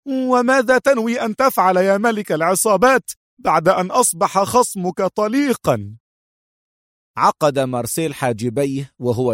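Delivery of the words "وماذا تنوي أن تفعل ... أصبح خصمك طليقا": sharp question. A narrator's reading. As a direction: challenge him sharply, much higher. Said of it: "سألته سونيا في حدة مماثلة".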